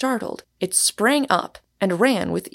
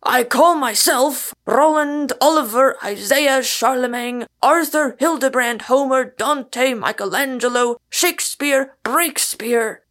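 roared list of names; 5 semitones higher, 6 dB louder than usual. A narrator's reading. Man roaring names from tree.

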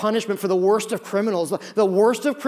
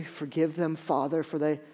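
soft, lower, quiet plea; calm forgiveness; 4 semitones lower, 6 dB quieter than usual. Acted. Jesus asks the Father to forgive, soft calm prayer. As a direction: pray it gently and softly.

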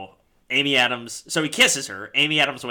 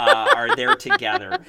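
playful; 10 dB louder than usual. Acted. Joke after [name] slip.

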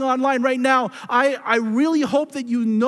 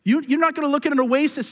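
fiery preaching; 5 semitones higher than usual. Acted. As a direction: push each line harder like a call to action. Preaching builds to full force.

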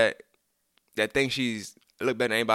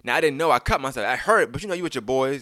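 ordinary talk

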